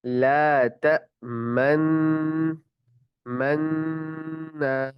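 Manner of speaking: ला तमन- मन्ना very slow, lips rounding on the held नून; recited with ishmam. Shown as a demonstration. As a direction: chant ला तमन्ना, rounding then releasing the lips. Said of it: "फिर ना पढ़ेंगे".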